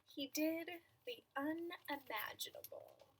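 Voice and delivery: measured storytelling with stretched key words; picture-book narration. A narrator's reading. Reader telling children about an imaginary friend, storytelling voice. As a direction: read it to small children, pausing between phrases.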